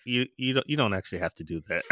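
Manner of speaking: low, soft therapist correction; hushed, low-pitched therapist; imitated therapist. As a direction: imitate a therapist gently correcting a client, quietly. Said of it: "There's like".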